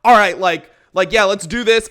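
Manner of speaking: imitating an overexcited friend hyping everyone up; mock loud enthusiasm. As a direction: burst out over-eager. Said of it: "It's like".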